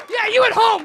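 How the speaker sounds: ordinary talk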